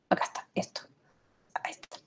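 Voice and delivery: barely audible whispered self-talk; muttering to self. Real, not acted. Lecturer finding the right window on screen, muttering to self. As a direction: murmur it under your breath, almost no voice.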